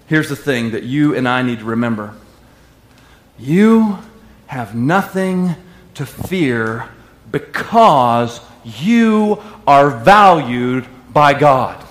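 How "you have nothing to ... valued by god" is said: very slow, drawn-out words; slow emphasis. Acted. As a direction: lay each word down slowly. Slowing down for emphasis.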